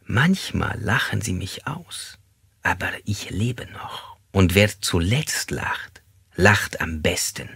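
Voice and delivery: slow, hushed confiding; conspiratorial, quiet. Acted. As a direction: lean in and share it softly, slowly. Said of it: "beugte er sich vor und erklärte leise".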